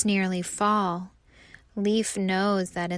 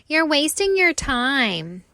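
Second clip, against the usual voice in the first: higher, louder know-it-all jeer; mocking leaves; 5 semitones higher, 7 dB louder than usual. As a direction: scoff at his effort, loud. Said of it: "the other leaves say again".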